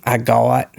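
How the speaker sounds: "agawat" with the vowel broken by w; Southern diphthong. Shown as a demonstration. Giving another Southern example, diphthongized "agawat". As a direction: glide from AH to WUT in "agawat". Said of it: "You'll hear".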